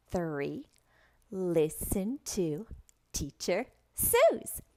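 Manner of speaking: slow and clearly separated words; teacher spelling out rules for a beginner; slow, clear rule-reading. Shown as a demonstration. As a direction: state each rule slowly, pausing between phrases. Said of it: "stating slowly and clearly".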